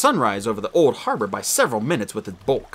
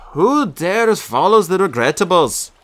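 slow, louder, hammed-up heroic call; game-style challenge; 6 semitones higher, 5 dB louder, slower than usual. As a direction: boom it like a video game hero. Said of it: "Ask me like we're in a game".

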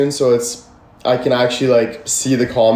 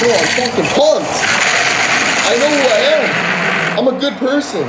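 higher, hyped declaration of self-belief; pumped up; 8 semitones higher, faster than usual. Real, not acted. Streamer answering haters, pumped and much higher. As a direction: fire yourself up, voice climbing. Defending himself to chat.

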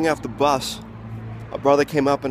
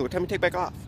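quick slurred version; 4 semitones higher, 6 dB quieter, much faster than usual. Shown as a demonstration. The guy at the bus rattling it off, quick and slurred. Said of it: "he said it so quickly".

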